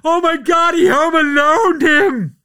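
high, loud amazed exclamation; excited yell over a wild play; excited shout. Real, not acted. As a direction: shout it in disbelief.

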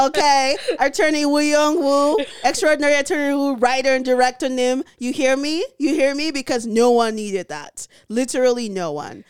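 loud, high ranting call-out; indignant, hammed-up tirade; mock outrage. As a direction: rail at the writers as if they can hear. Mock rant at drama's writers.

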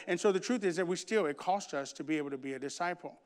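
ordinary talk

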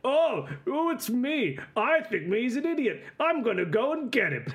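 exaggerated simpleton parody voice; mocking doppelganger. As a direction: swoop through it like a clueless buffoon. Game master voices the dopey copy.

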